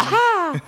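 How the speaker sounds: high-pitched delighted yelp; excited yelp. Real, not acted. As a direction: yelp with sudden glee. Laughing delight at a joke.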